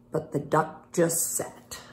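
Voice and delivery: soft unvoiced storytelling; whispered narration. A narrator's reading. Reading to kids, dropping to a whisper. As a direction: let the line fall to a whisper.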